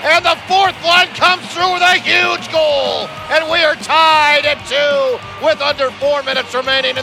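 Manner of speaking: loud excited goal call; goal call shout. Acted. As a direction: build to a roaring goal call.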